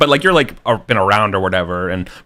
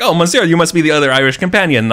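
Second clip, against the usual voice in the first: higher, louder, fast French-flavoured greeting; French innkeeper greeting the Irish guest, pitched up; 8 semitones higher, 5 dB louder, faster than usual. Retold or imitated. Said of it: "it's like".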